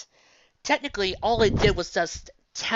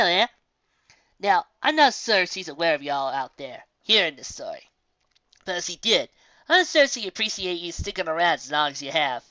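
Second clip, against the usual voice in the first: put-on louder, folksy old frontier lady; old country woman; 6 dB louder than usual. Old woman thanking the audience for listening, hearty and loud. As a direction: hold the voice of a hearty old woman who loves to talk.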